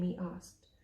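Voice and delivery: usual speaking voice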